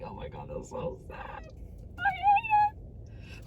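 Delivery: hammed-up sorrow over a sad book; mock despair. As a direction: groan it out like heartbroken. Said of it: "And I'm like".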